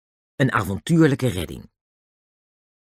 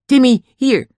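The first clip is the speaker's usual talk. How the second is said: high, loud call; 13 semitones higher, 8 dB louder than usual. A narrator's reading. George calls Timmy back, calling out loudly. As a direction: call the dog to heel sharply. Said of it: "riep George".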